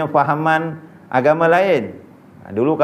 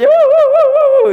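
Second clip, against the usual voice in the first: high, loud mock wail "wuh wuh"; mock wailing yell; 7 dB louder than usual. Preacher imitating the friend's fake funeral wail, loud and high. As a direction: yelp it like a hired mourner faking a cry. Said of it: "dia jerit".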